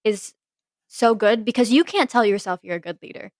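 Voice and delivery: normal talking voice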